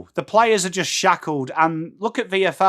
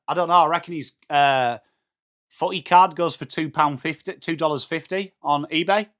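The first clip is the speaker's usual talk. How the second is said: ordinary talk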